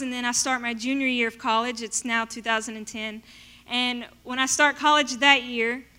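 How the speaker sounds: ordinary talk, nothing marked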